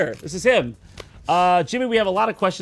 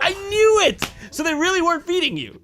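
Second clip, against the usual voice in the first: triumphant; 11 semitones higher than usual. Real, not acted. Suspicion confirmed, triumphant.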